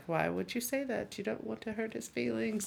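soft, hushed mock-mother reproach; a quiet, offended mother; hushed scolding. Mom scolding nanny about doll.

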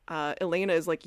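normal talking voice

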